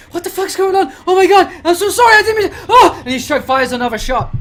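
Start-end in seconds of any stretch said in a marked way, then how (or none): 0.1-2.6 high, loud, frantic and apologetic; panicked apology. Acted. Other scientist panicking after firing the gun. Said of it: "the other scientist is like". 2.7-3.0 high loud startled oh; shocked cry. Acted. Scientist crying out as the shot goes off.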